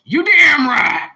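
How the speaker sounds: loud and slow, rising high; loud proud agreement. Real, not acted. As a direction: boom it out with pride.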